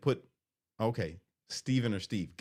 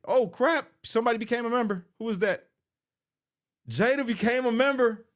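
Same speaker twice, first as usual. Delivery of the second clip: much higher and louder, a burst of real joy; excited surprise; 13 semitones higher, 5 dB louder than usual. Real, not acted. Streamer to chat, thrilled that Jada became a member. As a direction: yelp out the news of a new member. New member joins the live.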